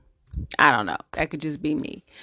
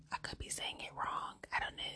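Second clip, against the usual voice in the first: whispered aside; 19 dB quieter than usual. Real, not acted. Unsure aside to viewers.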